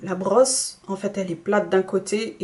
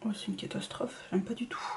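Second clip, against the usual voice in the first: much softer unvoiced whisper; horrified whisper; 12 dB quieter, faster than usual. Real, not acted. To viewers, whispering she hates the result. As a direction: breathe the verdict like a secret.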